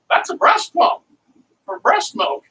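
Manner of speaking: high imitated eager seller voice; excited lady. Yard-sale lady explaining the pump, imitated high and eager. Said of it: "She's all excited to show me".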